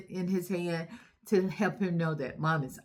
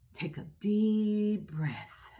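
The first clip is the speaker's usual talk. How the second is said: slow deep breath; 5 dB quieter, much slower than usual. Shown as a demonstration. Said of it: "Let's do it, boys and girls".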